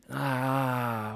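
low groan of shame; a low ashamed groan; pained groan. Acted. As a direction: groan as if the memory hurts. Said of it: "what a bad example".

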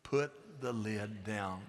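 low, quiet, measured words; slow, deliberate instruction; slow dictation. Shown as a demonstration. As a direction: lead a group repeat word by word. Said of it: "Say that with me".

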